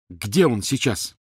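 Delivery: plain reading voice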